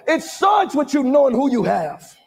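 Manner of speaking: ordinary talk, nothing marked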